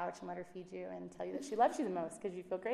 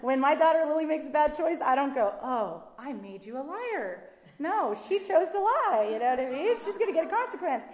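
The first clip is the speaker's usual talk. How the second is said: much louder and higher, preaching hard; full-force sermon delivery; fired-up preaching; 6 semitones higher, 12 dB louder than usual. Real, not acted. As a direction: preach it out loud to the room.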